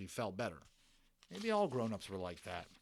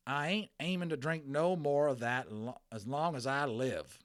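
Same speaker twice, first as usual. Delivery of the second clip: loud, higher Texas cowboy vow; Texas accent; 4 semitones higher, 4 dB louder than usual. Acted. Texas cowboy refusing the boy's water. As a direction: swear off drink in a Texas twang. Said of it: "in his strong Texas accent".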